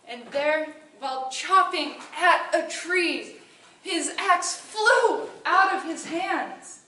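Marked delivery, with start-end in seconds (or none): 3.8-6.8 slow dramatic build. A narrator's reading. Dramatic moment in narration.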